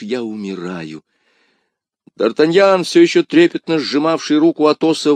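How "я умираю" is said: fading, dying. Acted. Dying plea fades.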